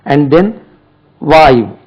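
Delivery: First thrive and then wife, slow with a stress on then; teacher stressing a rhyming proverb. Shown as a demonstration. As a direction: spell out the saying slowly for students. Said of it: "அது உள்ளே wife சொல்றாங்க".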